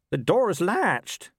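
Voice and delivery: high, slow farmer voice; puzzled farmer. Acted. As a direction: insist slowly as the farmer.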